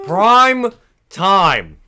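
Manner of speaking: high, very loud, drawn-out announcer call; hammed-up segment shout. Host announcing the prime time segment, hammed-up shout. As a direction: belt it out like a show intro. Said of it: "the worst prime time I've ever heard".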